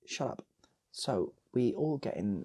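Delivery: usual speaking voice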